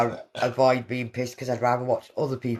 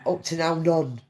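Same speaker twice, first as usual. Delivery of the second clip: normal talking voice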